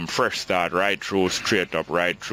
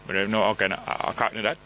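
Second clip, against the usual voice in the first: ordinary talk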